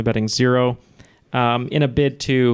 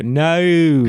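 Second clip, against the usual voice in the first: Australian "No."; 5 semitones higher, 4 dB louder than usual. Retold or imitated. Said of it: "when certain Australians say no".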